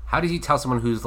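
ordinary delivery

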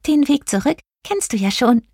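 high-pitched playful shooting star; squeaky, jokey character voice; joking little star. A narrator's reading. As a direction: tease in a tiny high voice. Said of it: "scherzte Blinky".

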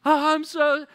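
sudden high, loud fake wail; hammed-up bawling, cut short; mock crying. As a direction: launch straight into an overdone sob. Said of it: "gets caught again".

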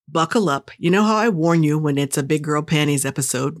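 usual speaking voice

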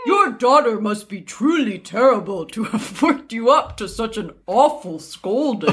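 swooping, hammed-up gentleman; man voice. Prince addressing the widow, put-on man voice. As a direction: put on a manly prince with big pitch swings. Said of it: "I'm gonna do my man voice".

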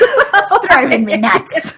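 slow, high, giggling punchline; laughing. Acted. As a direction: repeat it through laughter.

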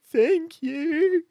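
high tearful thank you; sniffling boy. Acted. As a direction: thank her through sobs. Crying boy thanks for tissues.

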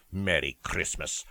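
ordinary talk, nothing marked

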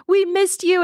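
high-pitched weepy imitation; mock crying. Recapper voicing the squad reuniting with their friend, mock crying. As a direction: play a tearful reunion in a high voice. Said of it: "they all start crying again".

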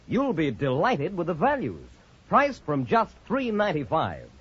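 ordinary talk

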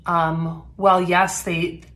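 ordinary talk, nothing marked

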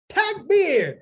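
high, loud lone call; hopeful call nobody answers; eager takbir call. Retold or imitated. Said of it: "I jumped up and I said".

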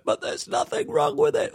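very high-pitched objection; mock protest. Everybody objecting to Mark fixing it, high mock protest. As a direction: protest in a squeaky put-on falsetto.

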